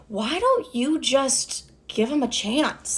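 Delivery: slow, soft therapist voice. Retold or imitated. Therapist suggesting a second chance to client, slow and soft. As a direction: gently float the suggestion, drawn out. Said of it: "my therapist goes".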